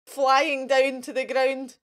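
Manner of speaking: ordinary delivery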